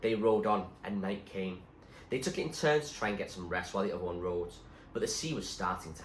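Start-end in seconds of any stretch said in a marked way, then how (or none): none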